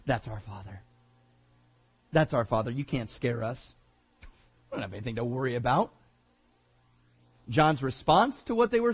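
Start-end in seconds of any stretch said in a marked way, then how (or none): 0.0-0.8 mock smug, pitched low and soft; a lightly voiced proud listener, dropped down low; smug low muttered boast. 2.1-2.7 repeated lower but fuller, a hint of defiance; smug repeated boast. 2.7-5.9 mock smug, low and quick; smug dismissal.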